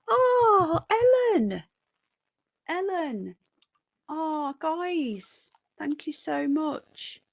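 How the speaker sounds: high, overwhelmed cry of gratitude; blown away. Real, not acted. Streamer blown away by a donor's kindness, overwhelmed. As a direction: start with a big high gasp of amazement, then ease off. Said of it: "I'm blown away".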